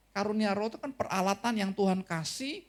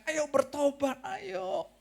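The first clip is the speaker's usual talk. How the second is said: high, pleading preacher calling people to repent; an old prophet's high coaxing call; Noah pleading; 10 semitones higher, 3 dB quieter, slower than usual. Retold or imitated. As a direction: beg the crowd to turn back, gently urgent. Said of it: "ya saya bahasakan khotbahkan".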